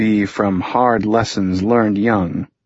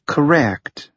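clipped; 5 semitones higher than usual. A narrator's reading. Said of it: "Harper's speech was clipped, precise".

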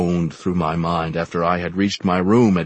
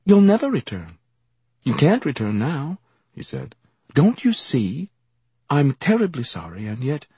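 higher-voiced intense young gentleman; earnest young man; 6 semitones higher than usual. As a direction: urge him, pained and earnest.

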